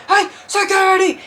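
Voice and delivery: high imitated shout; mock urgent holler; calling for security. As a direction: yell across a crowd for help. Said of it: "but all I".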